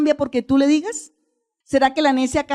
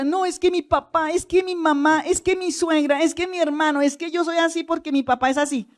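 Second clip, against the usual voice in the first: higher-pitched litany of excuses; mock excuse-making; 5 semitones higher than usual. Preacher mimicking people who blame their family, mock excuse-making. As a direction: whine out excuses in a raised voice.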